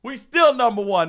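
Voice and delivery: loud, high taunting holler; imitating a heckler at full volume; retold defiant yelling. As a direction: holler it up at a bus full of rivals. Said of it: "he's yelling up at the bus".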